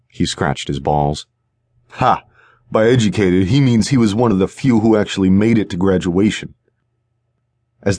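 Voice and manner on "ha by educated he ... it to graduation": jeering thug. Acted. Second thug jeers at partner.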